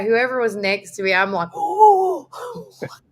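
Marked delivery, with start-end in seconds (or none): 1.5-3.0 high-pitched frightened shriek, acted out; mock horror. Tourist grabbing whoever's next to her, mock horror. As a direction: recoil with a hammed-up yelp. Reenacting shock at seeing the rat.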